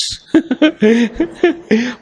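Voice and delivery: high running giggle; amused laugh. Real, not acted. Speaker amused by the mix-up, high laugh. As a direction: laugh freely at your own joke. Laughing at own knot anecdote.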